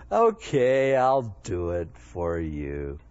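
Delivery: slow, sinking mock resignation; reluctant agreement. Jesus giving in to his mom, playfully reluctant. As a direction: give in to Mom, dropping low and drawn out. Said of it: "he was a little resistant".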